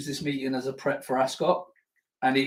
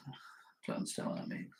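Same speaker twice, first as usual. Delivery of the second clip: whisper; 20 dB quieter, faster than usual. Real, not acted. Confused by livestream tags.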